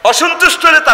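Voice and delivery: high, loud peak cry; preaching shout. Real, not acted. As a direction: hit the word like a pulpit climax. (মঞ্চ কাঁপানো চিৎকার)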